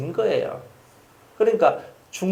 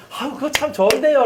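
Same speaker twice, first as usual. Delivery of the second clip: enthusiastic praise; 10 semitones higher, 5 dB louder than usual. Retold or imitated. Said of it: "박수 쳐주고".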